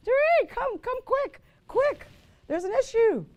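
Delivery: high, loud, frantic yell of the mother; imitating mother yelling in a panic. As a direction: shriek for help like a panicked mom. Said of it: "she yelled out".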